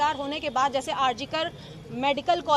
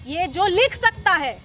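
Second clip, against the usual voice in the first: loud, higher, furious outcry at the accused's friends; angry shout; 6 semitones higher, 9 dB louder than usual. Real, not acted. Activist shouting to reporters against her abuser's friends, furious. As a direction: raise your voice in outrage, nearly shouting. Outraged woman addressing reporters.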